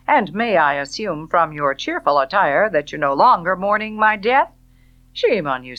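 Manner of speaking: loud, fast, sardonic man's voice; mocking Rhett. A narrator's reading. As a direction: needle her with cruel mockery.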